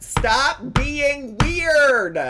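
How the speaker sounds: high, loud, very slow plea; drawn-out shout. Real, not acted. Challenging the internet for the year, shouted slowly. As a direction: bellow it, stretching every word.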